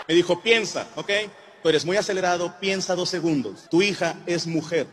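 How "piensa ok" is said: ordinary delivery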